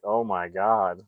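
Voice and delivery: usual speaking voice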